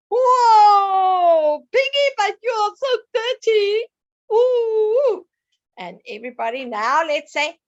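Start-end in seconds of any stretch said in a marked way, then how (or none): 0.1-5.3 louder, higher gasp of mock disgust; teacher pretending shock at Piggy's mud, louder and higher. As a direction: act appalled at the muddy pig. Teacher reacts to muddy piggy.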